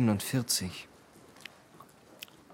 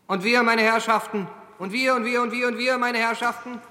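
town crier; 9 semitones higher than usual. Acted. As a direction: rouse the crowd like a carnival barker. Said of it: "Versetzen Sie sich in die Figur des Ausrufers".

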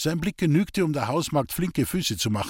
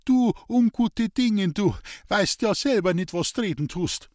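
high, louder outburst of anger and hurt; angry and grieved; 8 semitones higher, 3 dB louder than usual. Acted. Old man rounds on the gossiping maid, angry and hurt. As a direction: flare up at the maid in grief. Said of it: "fuhr Hans-Peter in Zorn und Kummer auf".

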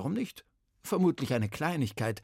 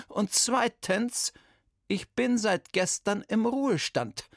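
a lightly voiced indignant old lady, higher; Bertha's stern voice; 8 semitones higher than usual. Old detective scolds the kidnapping boy, indignant.

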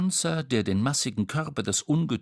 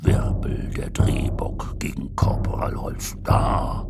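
very deep, whispery rumble; low husky giant beast; gruff Bastrum; 10 semitones lower than usual. A narrator's reading.

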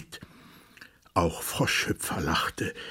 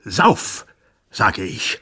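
screaming; 6 dB louder, slower than usual. Acted. Monstrous king orders the dwarf to drink, screaming. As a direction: scream the command. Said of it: "schrie das Ungeheuer".

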